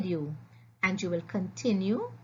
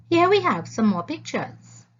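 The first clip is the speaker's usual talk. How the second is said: ordinary talk, nothing marked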